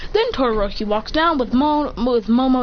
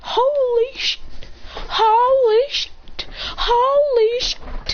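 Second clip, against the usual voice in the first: high, drawn-out squeal; shocked exclamation; 12 semitones higher, much slower than usual. Acted. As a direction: stretch it out in mock shock. Shock at seeing strange kid.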